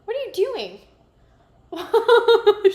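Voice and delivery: high, loud, laughing question to the pet; laughing at the dog. Real, not acted. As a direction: giggle at the somersaulting dog.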